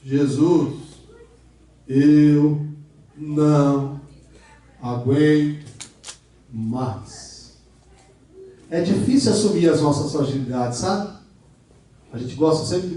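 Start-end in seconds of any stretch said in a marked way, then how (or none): 0.0-7.5 weary plea. Acted. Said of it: "Pedro fala com a pessoa certa".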